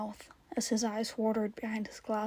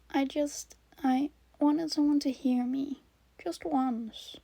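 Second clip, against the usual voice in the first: put-on higher child's voice, hesitant; small, halting plea in a higher pitch; pleading child; 4 semitones higher than usual. Child asking radio host to hear him, pleading.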